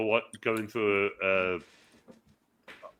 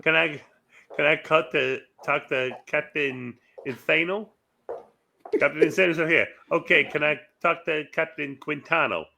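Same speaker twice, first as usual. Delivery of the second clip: slightly raised Waterboy character voice; Bobby Boucher; 4 semitones higher than usual. Acted. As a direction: imitate the Waterboy asking on the phone. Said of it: "you sounded like Bobby Boucher in".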